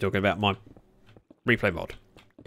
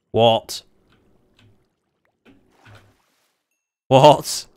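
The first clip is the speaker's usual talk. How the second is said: surprised; 3 semitones higher, 10 dB louder than usual. Real, not acted. Unexpected trident drop.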